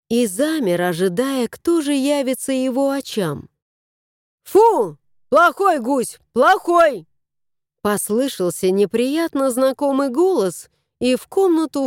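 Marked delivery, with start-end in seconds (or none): 4.4-7.1 loud, higher scolding of a dog; scolding dog owner. Acted.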